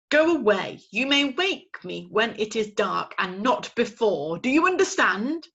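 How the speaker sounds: loud, stern owl scolding; cross father owl. Acted. Father owl scolding his owlet. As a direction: lay down the law.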